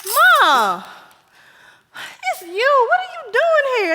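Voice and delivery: high, loud and laughing with shock; jump, then laugh at your own scare; startled. Acted. Daughter finds her mother in the house, startled laugh.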